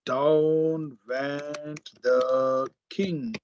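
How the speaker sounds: Down drawn out very slowly at the front; stress on down. Shown as a demonstration. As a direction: lean hard on Down, slow and deliberate. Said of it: "Now I want to highlight this".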